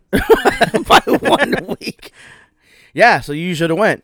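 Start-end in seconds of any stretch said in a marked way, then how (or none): 0.1-2.1 high, slow laughing jab. Real, not acted. Teasing his cousin about a one-week gap, laughing.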